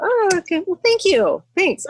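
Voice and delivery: high, polite re-enacted thanks; awkward thanks. Hiker to a consoling stranger, awkward thanks.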